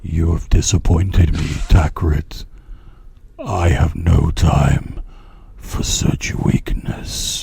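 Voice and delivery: low, loud, slow dark-lord boom; deep booming voice. Acted. Shadowy master scolds his failed servant, booming. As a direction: speak like the Mordor tongue in Lord of the Rings. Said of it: "a deep booming voice".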